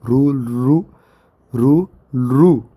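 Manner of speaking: रू drawn out slowly, sound repeated; pronunciation demo. Shown as a demonstration. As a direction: say रू slowly and clearly, again and again. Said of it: "इसका थोड़ा pronunciation जटिल है". (रू का उच्चारण धीरे-धीरे दिखाना)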